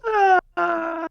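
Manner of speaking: high, loud drawn-out fall cry; falling scream. Acted. Thug screams as he falls from the balcony. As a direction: scream as you drop away.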